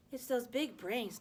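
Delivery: slow, knowing pronouncement; mock wise. A narrator's reading. Boy teasing, slow mock-wise explanation. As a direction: say it slowly like a sage. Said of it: "Nesbon supplied wisely".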